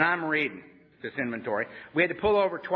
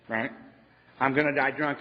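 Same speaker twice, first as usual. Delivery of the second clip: ordinary talk, nothing marked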